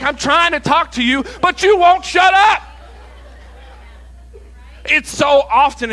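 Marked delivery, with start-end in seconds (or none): 0.0-2.6 high, loud mock yell; hammed-up frustration rising to a yell; exasperated God. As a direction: build to a shouted outburst, trying to get a word in. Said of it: "And God's like".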